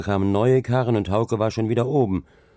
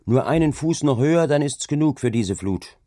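higher, louder urging; shouted encouragement; 7 semitones higher, 4 dB louder than usual. A narrator's reading. Hauke urging workers to hold out against the flood, shouting. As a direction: call down to keep them going. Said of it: "schrie er zu ihnen hinab".

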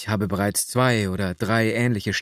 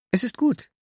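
put-on a lightly raised young woman's voice, rising at the end; young girl's voice. A narrator's reading. Nastjenka agreeing with the dreamer, lightly voiced young woman. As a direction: gently voice the girl, a touch higher. Girl answers the narrator.

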